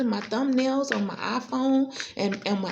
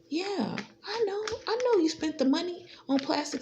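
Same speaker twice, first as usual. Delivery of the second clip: smug knowing; 6 semitones higher than usual. Real, not acted. Knowing taunt.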